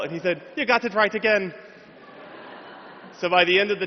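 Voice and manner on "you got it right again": Irish professor. Retold or imitated. Professor voiced.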